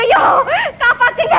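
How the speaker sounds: high, shouted plea; crying for help. Acted. Woman being dragged begs to be saved, shouting. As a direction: call out in distress for rescue.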